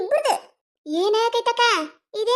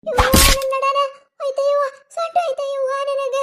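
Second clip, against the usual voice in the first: pained wail; 5 semitones higher, 3 dB louder than usual. Acted. Pushed off, wails in pain.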